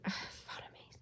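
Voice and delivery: sighed, whispered test of the word; practising to oneself. Real, not acted. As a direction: whisper it under your breath as a rehearsal. Practising the hard word to self.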